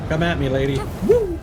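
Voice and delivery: ordinary talk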